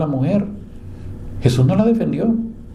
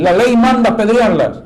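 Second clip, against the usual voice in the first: higher and louder, jabbing at manda; accusing insistence; 8 semitones higher, 5 dB louder than usual. Retold or imitated. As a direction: throw the law at him. Accusers citing the law.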